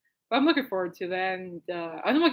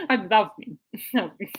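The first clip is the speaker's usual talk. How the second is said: ordinary talk, nothing marked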